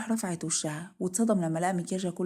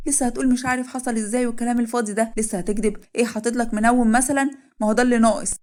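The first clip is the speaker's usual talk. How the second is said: higher, loud and scornful; angry sarcastic outburst; 4 semitones higher, 7 dB louder than usual. Acted. Wife calling husband a liar before family, furious sarcasm. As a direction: hurl his lie back at him, building louder.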